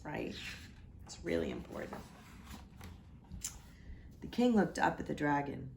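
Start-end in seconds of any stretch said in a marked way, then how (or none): 0.0-2.0 hushed aside about the page; whispered aside. Real, not acted. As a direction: whisper it as a secret. Aside to listeners.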